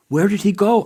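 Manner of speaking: a quick, alarmed where did he go; sudden question. A narrator's reading. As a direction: ask it abruptly, caught off guard. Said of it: "Asim asked suddenly".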